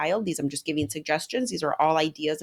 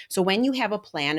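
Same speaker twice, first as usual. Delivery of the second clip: usual speaking voice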